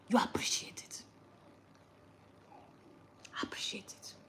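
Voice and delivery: hushed firm whisper; whispered admonition. Real, not acted. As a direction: whisper the lesson home. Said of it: "whispered admonition".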